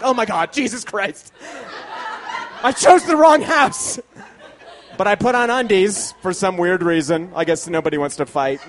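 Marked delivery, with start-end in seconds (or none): 0.0-4.0 burglar's rising panic, ending louder and higher; hammed-up terror at the sight; mock shriek of a scared intruder; mock horrified intruder. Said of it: "They'd be like".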